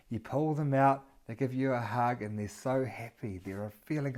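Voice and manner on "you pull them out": usual speaking voice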